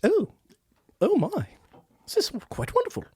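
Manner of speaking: high, soft, delighted British royal; posh, airy English accent; Queen of England. Acted. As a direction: savor it as a dainty monarch. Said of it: "Back in character".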